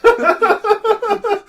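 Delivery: breaking into high laughter; laughing hard. Real, not acted. Reading the author's note, dissolving into high laughter. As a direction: lose it while reading.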